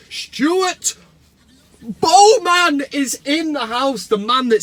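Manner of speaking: high, loud, slow hype announcer; MC announcement. Pretend. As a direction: bellow it like a ring announcer. Announcing a viewer like an MC.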